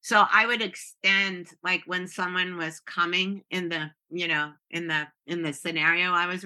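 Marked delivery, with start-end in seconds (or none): none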